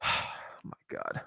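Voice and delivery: breathy, slow, fed-up whisper; exasperated whisper. Real, not acted. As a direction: whisper it in disbelief.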